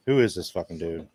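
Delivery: ordinary delivery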